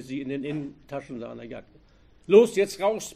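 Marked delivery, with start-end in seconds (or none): 2.3-3.1 harsh order, higher and much louder. A narrator's reading. Robber ordering the hostage out, loud command. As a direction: command them out. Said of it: "befahl er".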